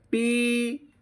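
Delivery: Peacock split into पी, pause, कॉक; syllable by syllable. Shown as a demonstration. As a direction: draw out each syllable of peacock, pitched high. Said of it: "syllable मतलब pause जो होता है".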